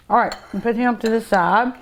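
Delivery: ordinary talk, nothing marked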